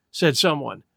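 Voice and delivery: ordinary delivery, nothing marked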